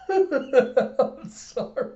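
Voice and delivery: real laughing fit, words squeezed out high; breaking down into voiceless wheezes of laughter; helpless laughing. Real, not acted. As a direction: try to apologize while cracking up. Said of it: "I heard myself laughing".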